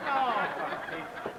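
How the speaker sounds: slow, laughing exasperation. Acted. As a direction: chuckle the words out. Laughing through the words.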